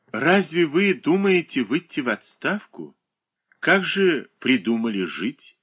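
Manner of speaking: a touch of lively curiosity; animated. A narrator's reading. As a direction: ask it with sudden interest. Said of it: "с оживлением спросила".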